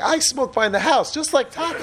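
put-on high childish boast through a laugh; playful kid voice, giggling; imitating little son. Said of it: "He's like".

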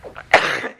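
loud mock throat-clearing; imitated cough. Showing listeners how those guys go, a loud cough. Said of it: "go like that".

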